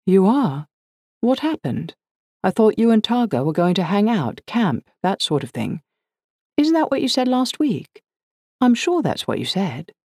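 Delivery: high, affected English questioning; aristocratic English accent. A narrator's reading. Liz questions daughter about her summer plans, aristocratic English accent. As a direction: put on upper-crust English, pitched well up. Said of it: "manufactured aristocratic English accent".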